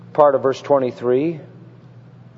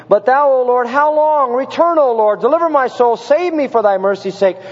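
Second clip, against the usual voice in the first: high, urgent plea, easing at the end; crying out; 7 semitones higher, 3 dB louder than usual. Retold or imitated. Preacher voicing the psalmist begging God for mercy, crying out. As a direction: cry it up to heaven, pleading. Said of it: "The psalmist cries out in Psalm six".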